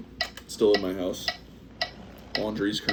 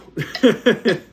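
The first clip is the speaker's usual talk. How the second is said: loud laugh; 12 semitones higher, 8 dB louder than usual. Real, not acted. Laughs at sitting on chair edge.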